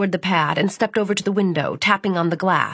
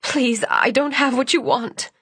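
frightened plea; 5 semitones higher than usual. Acted. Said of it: "she murmured".

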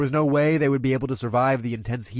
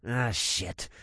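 growled; 2 semitones lower, 6 dB quieter than usual. A narrator's reading. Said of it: "I growled".

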